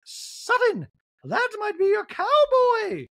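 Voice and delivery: very high, slow impression; Dorit. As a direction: call out teasingly in a piercing put-on voice. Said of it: "Dorit's like".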